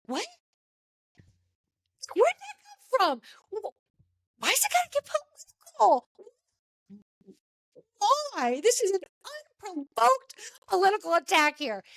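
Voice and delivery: put-on outraged surprise at a drunk guest's sudden politics; incredulous shock. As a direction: reel back in total disbelief.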